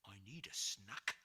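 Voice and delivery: a hungry giant rumbling. Acted. Giant to George, hungry and low. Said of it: "But the giant said".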